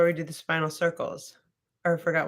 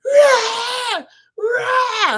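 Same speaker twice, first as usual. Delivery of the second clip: much higher, louder mock yell; retold scream; 8 dB louder than usual. Hearing a client scream in the waiting room, mock yell. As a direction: belt out a stranger's pained scream. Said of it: "Someone's like".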